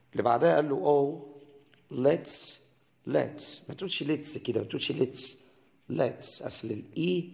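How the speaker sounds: ordinary talk, nothing marked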